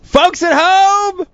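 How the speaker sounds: high, slow, loud show opener; announcer call-out. Acted. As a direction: call out to the viewers like a host. Host opening web show, calling out.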